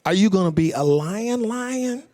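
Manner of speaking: concerned daughter. Retold or imitated. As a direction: question Daddy like a worried child. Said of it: "your daughter asked you".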